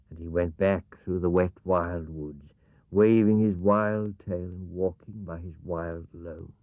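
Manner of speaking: plain reading voice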